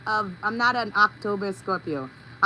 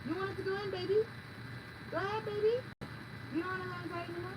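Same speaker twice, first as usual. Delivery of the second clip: coaxing a pet; 6 semitones higher, 14 dB quieter, much faster than usual. Real, not acted. She coaxes her pet Punchy to go in, soft baby talk. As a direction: coax the little one gently inside.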